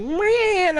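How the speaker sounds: cat voice. Retold or imitated. Voicing cat's reply.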